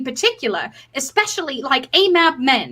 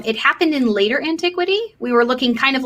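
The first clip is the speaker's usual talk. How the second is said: ordinary talk, nothing marked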